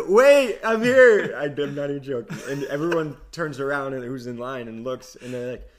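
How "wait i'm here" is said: high, loud desperate shout. Retold or imitated. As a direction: yell across the terminal. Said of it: "And I said".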